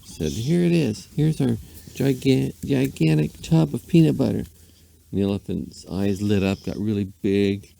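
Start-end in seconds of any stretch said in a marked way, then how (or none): 0.3-4.4 lady's voice. A narrator's reading. Lady presenting the tub.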